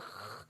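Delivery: خ said with a rasping snore-like friction; gargled kha. Shown as a demonstration. Teacher showing the snoring sound of خ. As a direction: rasp خ in the throat. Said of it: "جس طرح خا کے اندر خیغوغت".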